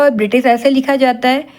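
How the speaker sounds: normal talking voice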